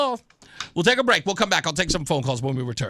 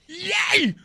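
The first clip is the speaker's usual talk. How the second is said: high, loud triumphant whoop; excited shout; 13 semitones higher, 8 dB louder, much slower than usual. Real, not acted. Host crowing to cohost Rosette, excited shout. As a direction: crow it out in pure delight. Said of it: "I get excited".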